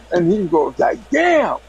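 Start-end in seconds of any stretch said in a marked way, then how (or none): 1.1-1.6 high-pitched mock amazement; astonished. Friend learns he was pranked, high mimicked astonishment. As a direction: imitate a fooled friend, pitch leaping up.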